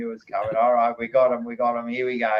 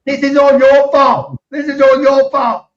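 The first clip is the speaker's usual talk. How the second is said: much higher and louder playful accusation; mock outraged yell; 13 semitones higher, 11 dB louder than usual. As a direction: yell the blame at him in jest. Mock blame at joke-telling cohost.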